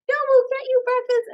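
high, cheerful insistence, imitating crew; insistent crew voice. Crew insisting to the new actress, high and cheerful. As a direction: brush off her offer sweetly. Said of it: "It's like".

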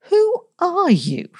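high, slow insistent question; demanding. A narrator's reading. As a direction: demand an answer, slowly. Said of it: "she demanded".

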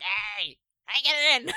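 loud, high mock yell; Danny DeVito. Co-host playing the monster at the window, hammed-up yell. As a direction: holler it as a cartoonish impression. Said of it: "It's Danny DeVito slamming on the window".

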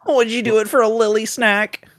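very high squeaky voice; cartoon voice asking a pet. Cartoon voice to Lily.

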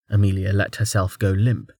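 ordinary delivery

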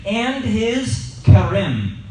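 kerem slowed right down, Hebrew style; lingered over kerem like a teacher; Hebrew word kerem. Shown as a demonstration. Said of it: "his vineyard".

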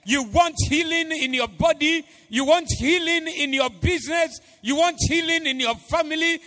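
fervent sermon climb; preaching at full force. Acted.

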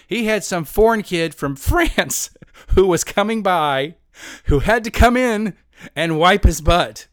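high, loud, slow mock outrage; hammed-up screaming rant; imitated screaming. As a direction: yell it phrase by phrase as an appalled friend. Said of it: "he's screaming at this point".

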